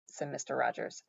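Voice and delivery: ordinary delivery, nothing marked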